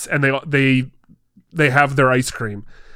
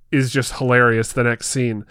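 ordinary delivery

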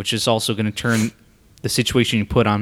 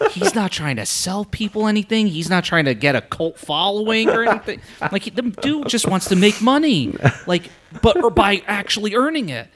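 ordinary talk, nothing marked

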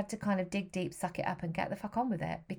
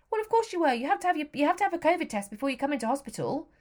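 much higher, mock-patronising nurse voice; condescending nurse; 8 semitones higher, 4 dB louder, faster than usual. Nurse talking down to the patient, higher and patronising. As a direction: talk down to her like she should know. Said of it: "speaking to me as if, you know, I should know this shit".

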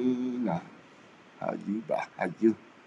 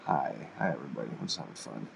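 soft aside; 11 semitones lower, 14 dB quieter, faster than usual. Real, not acted. Quiet asides to viewers.

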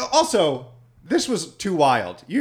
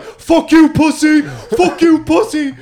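high, much louder hammed-up yelling; mock screaming taunt; 14 semitones higher, 9 dB louder, slower than usual. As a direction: scream it at the car window, imitating the buddy. Buddy banging on minivan window.

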